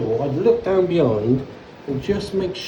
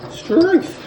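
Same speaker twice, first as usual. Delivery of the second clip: mock strongman; 5 dB louder than usual. Strains fixing the waste.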